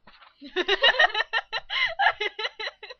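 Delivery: long, high-pitched burst of laughter; laughing fit. Real, not acted. Voice actor losing it after a take, laughing. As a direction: crack up helplessly for several seconds. Cracks up during dubbing takes.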